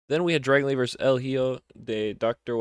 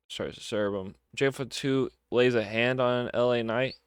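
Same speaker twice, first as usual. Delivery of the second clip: normal talking voice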